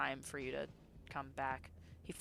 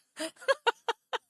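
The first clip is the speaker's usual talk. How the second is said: high loud laugh; 12 dB louder than usual. Real, not acted. Streamer laughs at herself.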